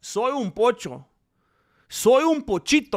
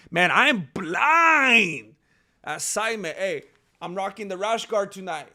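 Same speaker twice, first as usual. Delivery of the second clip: ordinary talk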